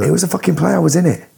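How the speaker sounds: exasperated insistence. Real, not acted. Arguing with his doubting co-host about a school play, exasperated.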